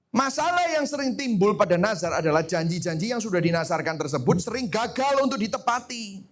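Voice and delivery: ordinary talk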